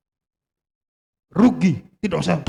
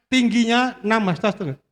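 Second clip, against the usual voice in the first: dramatic scripture reading; 5 semitones higher than usual. Acted.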